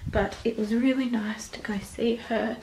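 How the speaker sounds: soft whisper at home. Real, not acted. Toddler asleep at home.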